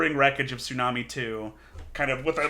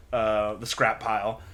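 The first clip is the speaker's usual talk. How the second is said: ordinary delivery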